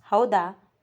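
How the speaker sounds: plain reading voice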